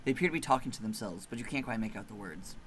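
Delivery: soft, hushed ominous telling; eerie story narration. A narrator's reading. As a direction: draw them into the dread quietly.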